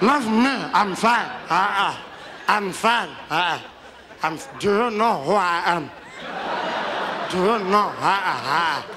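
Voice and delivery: stagger around waving people off; tipsy, boisterous elder; drunk Madiba. Retold or imitated. Drunk Madiba at his party.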